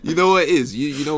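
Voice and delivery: laughing. Real, not acted. Laughing reply to mock threat.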